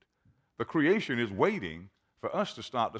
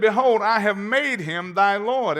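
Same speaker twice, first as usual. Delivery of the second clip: higher, much louder, ringing proclamation; proclaimed; 9 semitones higher, 9 dB louder than usual. Shown as a demonstration. Preacher proclaiming Isaac's words to the congregation, loud. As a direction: thunder the verse out. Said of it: "same verse preached loud".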